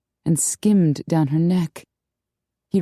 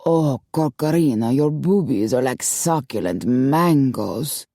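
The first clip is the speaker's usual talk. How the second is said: Latin murmur; 3 semitones lower than usual. A narrator's reading. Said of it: "Don Orlando's voice murmured".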